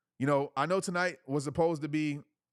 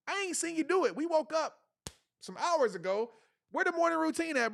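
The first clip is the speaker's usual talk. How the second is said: higher, teasing challenge to a fellow speaker; mock call-out. Calling out a fellow speaker about his routine, teasing. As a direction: catch him out with a grin.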